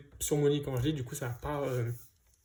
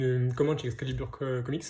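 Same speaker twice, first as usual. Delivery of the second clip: ordinary delivery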